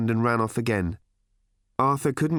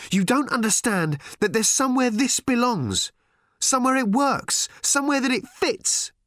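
high, raised voice, insisting furiously; angry outburst; 14 semitones higher than usual. A narrator's reading. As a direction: hurl each phrase out in anger. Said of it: "her face dark with anger".

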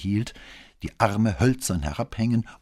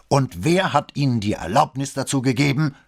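loud, high, severe demand; forceful military interrogation; very stern; 10 semitones higher, 7 dB louder than usual. A narrator's reading. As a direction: rebuke the cadet with a sharp question. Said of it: "fragte Bembo in noch strengerem Ton".

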